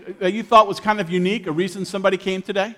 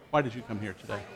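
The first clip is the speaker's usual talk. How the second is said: softer, lower repeat of the question; quiet repeat; 9 semitones lower, 11 dB quieter, faster than usual. Shown as a demonstration. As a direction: ask it again, quietly, to a neighbour. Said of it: "Marcus, you go ahead and share here".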